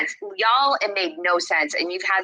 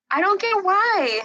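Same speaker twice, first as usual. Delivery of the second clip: much higher, near tears; overwhelmed disbelief; 11 semitones higher, 3 dB louder than usual. Real, not acted. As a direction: voice cracking up with emotion. Overwhelmed, voice rising.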